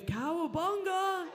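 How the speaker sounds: high, loud, stretched-out cowabunga; mock surfer whoop cowabunga; surfer cry. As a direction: shout it like riding a big wave. Mock surfer cry on suicide wave.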